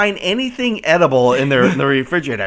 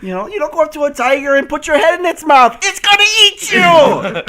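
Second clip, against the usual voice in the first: high, loud, laughing through the words; can barely get it out for laughing; laughing loud exasperation; laughing disbelief; 12 semitones higher, 8 dB louder than usual. Real, not acted.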